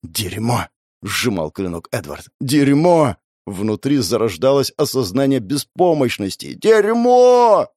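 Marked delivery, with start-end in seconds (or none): 2.4-3.2 raised, higher curse; exasperated exclamation; mounting frustration. Acted. Second, louder curse. 6.5-7.7 high, loud, drawn-out yell of frustration; full-voiced desperate howl; screamed curse. Third curse, screamed helplessly.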